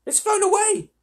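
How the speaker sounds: put-on very high, loud dismayed girl; urgent girlish cry. A narrator's reading. Lucy tells the others the robin has gone, high dismayed cry. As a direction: cry out as the bird escapes. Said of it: "cried Lucy".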